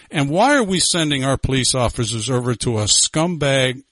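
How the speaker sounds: ordinary delivery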